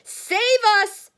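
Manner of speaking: high loud cry for help; yelling. A narrator's reading. Grandma crying out for rescue as elevator speeds, loud shriek. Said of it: "yelled Grandma Georgina".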